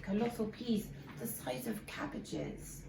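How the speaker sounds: ordinary delivery, nothing marked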